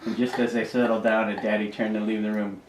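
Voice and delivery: plain reading voice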